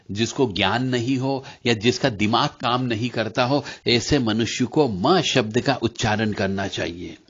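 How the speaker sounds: usual speaking voice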